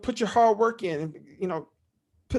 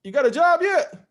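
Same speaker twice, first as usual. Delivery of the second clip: louder, higher teasing call to nephew; dad teasing; 6 semitones higher, 4 dB louder than usual. Retold or imitated. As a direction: needle him with a grin. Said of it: "he hits me on my shoulder".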